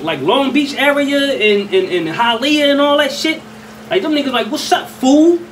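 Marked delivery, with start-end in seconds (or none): 4.5-5.4 a lightly voiced Cali street greeting, pitched up. Locals greeting a newcomer, lightly voiced casual. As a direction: toss off a casual what's up fool. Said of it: "that's how they say".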